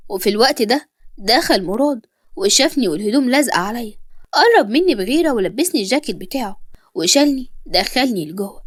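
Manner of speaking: usual speaking voice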